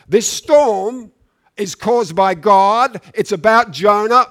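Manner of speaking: loud, driving sermon build; preaching at full force. Acted. Preacher warning the congregation about backsliding, loud and driving. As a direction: preach it hard to the room.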